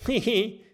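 a high, amused hihi giggle. A narrator's reading. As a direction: giggle at the plastic fangs. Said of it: "grinst Mathilda". (hohes, vergnügtes Kichern)

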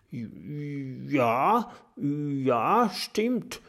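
scared little dragon stammering. A narrator's reading. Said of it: "stottert Kokosnuss". (ängstlich stotternder kleiner Drache)

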